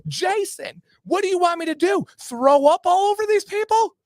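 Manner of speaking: very high exasperated outburst, building in volume; mock irate wife. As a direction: imitate a wife loudly scolding her husband. Wife yelling at husband in story.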